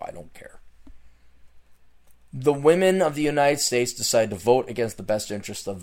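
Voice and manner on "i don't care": whispered. Real, not acted. Giving up, muttered.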